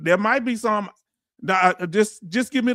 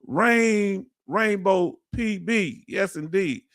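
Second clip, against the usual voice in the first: usual speaking voice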